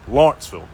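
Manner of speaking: "Lawrenceville" said quickly the Southern way, squeezed short; Southern pronunciation. Shown as a demonstration. Roofer showing viewers how the South says "Lawrenceville", Southern pronunciation. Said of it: "That's how they say it in the South".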